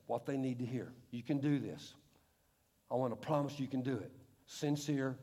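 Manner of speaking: low, soft, sincere encouragement; soft earnest appeal. Real, not acted. Pastor gently charging listeners with homework, soft and low. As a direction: lean in and speak gently.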